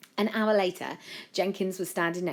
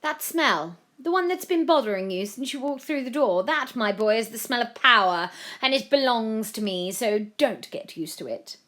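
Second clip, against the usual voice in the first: higher, louder, imperious villain; gloating; 7 semitones higher, 6 dB louder than usual. A narrator's reading. Violetta claims power before storming off, gloating. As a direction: relish it, then warn him off.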